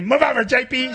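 teasing imitation of a bossy guest; mock brash demand. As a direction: shove past like a bold party girl, playfully. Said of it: "She'll say".

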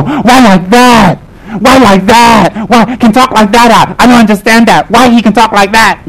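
high, drawn-out whine of disbelief; mock bewildered protest. Devotees meeting a blunt Rinpoche, mock bewildered protest. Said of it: "And they go".

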